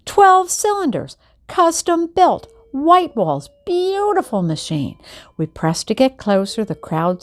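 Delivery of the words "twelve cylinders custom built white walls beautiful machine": excited. A narrator's reading. Dad admiring the car.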